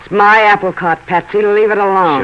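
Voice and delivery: loud angry warning to Novak; sharp warning. Acted. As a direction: tell him to back off, hard.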